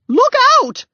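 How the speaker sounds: very high, loud warning cry; shout. A narrator's reading. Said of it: "shouted the woman".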